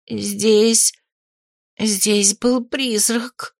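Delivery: slow, hesitant, frightened answer; trembling voice. A narrator's reading. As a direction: falter over it, a touch of fear. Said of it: "ответила она дрожащим голосом". (робко, медленно, дрожащим голосом)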